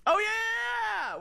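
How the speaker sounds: excited shout. Real, not acted. Sudden remembering outcry.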